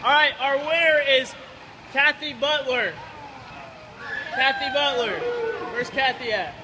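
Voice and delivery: loud, called-out announcement; announcing loudly. Real, not acted. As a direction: call the winner's name to the crowd. Announcing the raffle winner outdoors.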